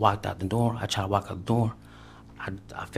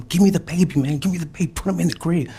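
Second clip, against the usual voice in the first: much higher, loud yelled demand; shouted demand; 9 semitones higher, 7 dB louder than usual. Retold or imitated. Man retelling yelling at girlfriend for the baby, loud and high. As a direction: yell it at her, all out. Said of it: "I was like".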